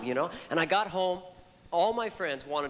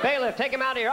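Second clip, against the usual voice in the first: judge; 8 semitones higher, 4 dB louder, faster than usual. Acted. Judge orders removal.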